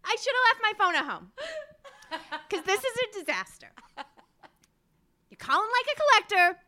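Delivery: high, exasperated mock phone rant; dramatic lyric reading. Guest refusing a pestering caller in lyrics, mock exasperation. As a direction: act fed up with the caller, hammed-up. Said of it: "have you read some lyrics of hers".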